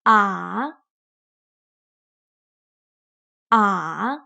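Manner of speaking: A dipping low then rising; A dropping down then back up; third tone. Shown as a demonstration. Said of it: "Let's try again".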